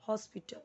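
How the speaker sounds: हॉस्पिटल with a rounded o up front; correct English pronunciation. Shown as a demonstration. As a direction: say हॉस्पिटल as "hos-pi-tl". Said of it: "English में आप क्या बोलोगे".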